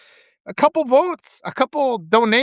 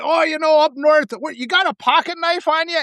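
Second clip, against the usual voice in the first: put-on higher-pitched folksy Wisconsin accent; Charlie Berens; 7 semitones higher than usual. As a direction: imitate a comic northern Wisconsin local. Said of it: "he's like".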